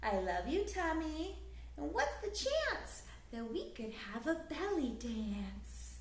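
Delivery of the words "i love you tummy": ordinary delivery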